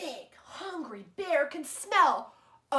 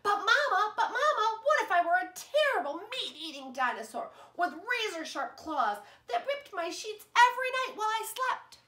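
put-on eager child; 8 semitones higher than usual. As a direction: ask Mama like an excited child. Child pleading to Mama.

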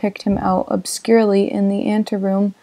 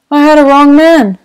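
put-on higher, louder old gangster backing off; Wolfsheim's voice; 5 semitones higher, 8 dB louder than usual. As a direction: shrug off the mix-up like a racketeer. Wolfsheim apologizes.

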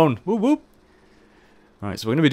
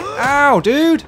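sharp, high, loud yelp of pain; 14 semitones higher, 6 dB louder than usual. Real, not acted. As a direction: yelp it like you just got stung. Gets hit in game, yelps.

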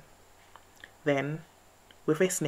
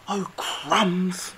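gasped; 4 semitones higher, 5 dB louder than usual. A narrator's reading. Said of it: "gasped Mouse".

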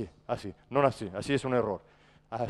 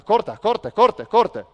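loud, higher call; 6 semitones higher, 11 dB louder, much slower than usual. Shown as a demonstration. Coach showing how to call the cut, loud. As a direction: call the cut to your teammate. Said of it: "y le llama".

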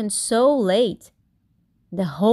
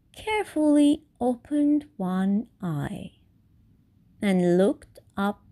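ordinary delivery

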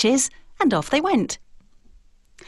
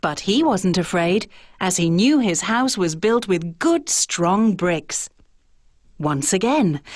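plain reading voice

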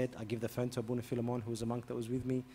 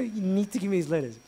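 louder, higher insistence; firm demand; 7 semitones higher, 7 dB louder than usual. Retold or imitated. Priest pressing the wife for letters, loud. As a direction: demand it plainly.

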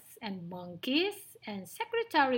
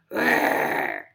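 long, loud, low animal roar; lion's roar; 10 dB louder than usual. Acted. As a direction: roar like a lion just woken. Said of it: "the lion woke up and roared".

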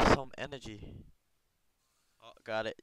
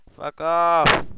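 frustrated curse; 6 semitones higher, 11 dB louder than usual. Real, not acted. Cursing at game frustration.

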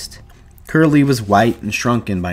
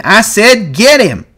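slow, high, loud shouted order; insistent full-voiced yell; 13 semitones higher, 8 dB louder, slower than usual. A narrator's reading. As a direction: bellow each word to make him act. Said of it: "George yelled again".

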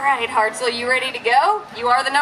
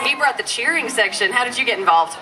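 ordinary delivery

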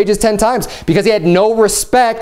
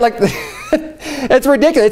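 laughing; 5 semitones higher than usual. Real, not acted. Mocking idea of stolen god.

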